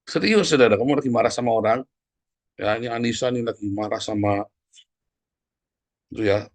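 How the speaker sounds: ordinary talk, nothing marked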